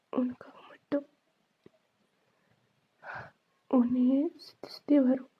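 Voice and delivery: hushed voiceless confession; tender whisper. Acted. Ghost speaks of his love.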